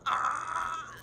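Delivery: high sharp shriek of delight; excited shriek. Real, not acted. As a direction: shriek at the twist. Reacting to a plot twist.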